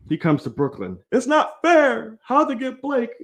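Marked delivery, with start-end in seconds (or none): 1.1-3.1 high whiny mock of complaining fans. Mocking fans who whine about Blake, high whiny voice. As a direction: whine it like a sore fan, high and slow. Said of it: "y'all can't dog this man".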